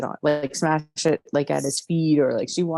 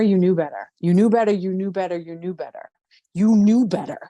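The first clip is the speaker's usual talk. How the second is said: louder, higher, hammering accusation; cathartic anger; 3 semitones higher, 5 dB louder than usual. Acted. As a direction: throw every word at him. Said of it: "create a bit of a cathartic release".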